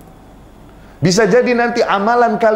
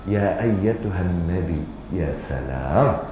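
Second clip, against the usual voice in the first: reverent; 14 semitones lower, 9 dB quieter, slower than usual. Retold or imitated. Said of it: "Allah menyebutnya".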